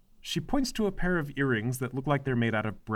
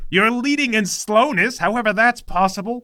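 a hint of a bossy, whiny mother; lightly voiced overbearing mom; nagging mother; 7 semitones higher, 7 dB louder than usual. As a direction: play the domineering mother scolding her son. Mother mocks son's leadership.

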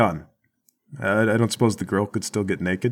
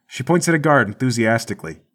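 ordinary talk, nothing marked

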